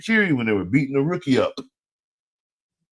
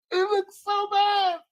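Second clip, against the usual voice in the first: high squeaky exasperated question; high-pitched mock disbelief; mock falsetto whine; 3 dB quieter, slower than usual. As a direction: squeal it at the team like a fed-up fan. Mock complaint continued.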